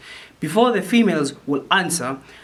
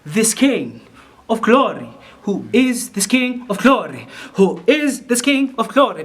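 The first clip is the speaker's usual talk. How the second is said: growing volume; 6 semitones higher, 4 dB louder than usual. Shown as a demonstration. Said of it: "in terms of voice and intensity, it grows".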